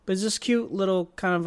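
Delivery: ordinary talk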